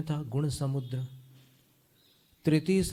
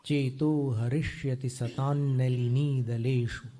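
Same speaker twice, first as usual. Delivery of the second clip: slow recitation; 2 semitones lower, slower than usual. Shown as a demonstration. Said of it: "teacher reciting verse slowly".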